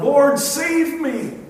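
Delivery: loud, slow cry for rescue, voice jumping high; desperate cry. Retold or imitated. Sinking Peter crying out to the Lord for rescue, loud desperate cry. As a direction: shout it like a man going under the waves. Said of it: "he cried out".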